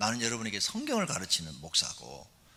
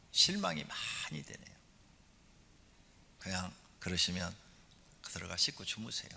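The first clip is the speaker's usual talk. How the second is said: usual speaking voice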